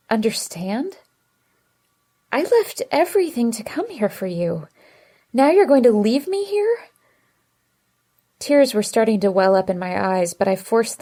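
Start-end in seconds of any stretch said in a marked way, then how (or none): 0.1-6.8 hurt protest. A narrator's reading. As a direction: let the hurt swell into an outburst. Hurt girlfriend rising in protest.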